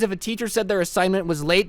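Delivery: ordinary delivery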